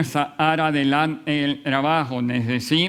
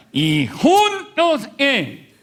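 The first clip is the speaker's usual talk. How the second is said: invita a la congregación a responder; 10 semitones higher, 4 dB louder, much slower than usual. Real, not acted. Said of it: "pide respuesta a la congregación".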